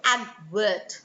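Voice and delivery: "advert" slowly, in two parts, AD-vert; demonstrated word stress. Shown as a demonstration. Teacher giving a stress example, slow "advert". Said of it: "Practice word stress".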